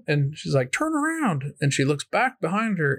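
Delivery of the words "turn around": excited coach. Retold or imitated. Coach voiced in retelling.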